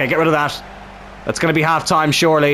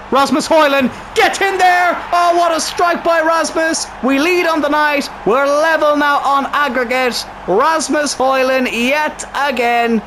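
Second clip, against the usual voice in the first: goal celebration; 9 semitones higher, slower than usual. Real, not acted. His striker scores the equaliser.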